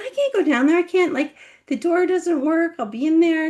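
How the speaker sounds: pleading protest. Retold or imitated. Daughter pleading with mom.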